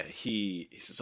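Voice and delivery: ordinary delivery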